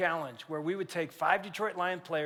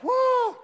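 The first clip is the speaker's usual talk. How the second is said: very high, louder woo; cheering whoop; 5 dB louder than usual. Retold or imitated. Players cheering the kick, high whoop. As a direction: whoop like the crowd.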